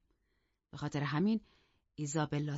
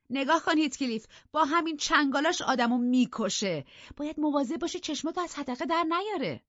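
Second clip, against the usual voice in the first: put-on higher, louder scornful young woman; scornful mockery; 9 semitones higher, 4 dB louder than usual. Catherine mocking Isabella before Heathcliff, loud and high. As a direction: drive her out with scorn.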